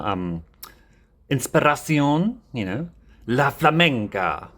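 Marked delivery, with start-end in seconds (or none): 1.3-4.5 put-on Spanish accent flourish. Playing up Spanish name.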